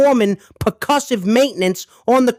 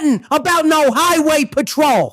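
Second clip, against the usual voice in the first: high, emphatic protest; raised-pitch, defensive outburst; indignant denial; 6 semitones higher than usual. Acted. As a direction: deny it all with indignation. Indignant denial when questioned.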